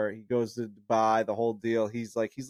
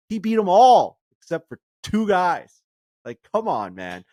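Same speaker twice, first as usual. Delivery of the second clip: louder, higher, slow and emphatic; exasperated exclaim; 6 semitones higher, 6 dB louder than usual. Real, not acted. Podcaster insisting to co-hosts, exasperated and loud. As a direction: drive the point home, worked up.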